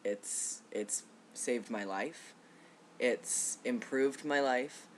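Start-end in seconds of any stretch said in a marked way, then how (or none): none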